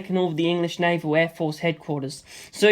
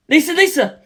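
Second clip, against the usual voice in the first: louder, higher cry of her name; alarmed shout; 10 semitones higher, 6 dB louder than usual. Acted. George shouting as Lisa Lisa jumps into thin air. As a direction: yell after her as she leaps.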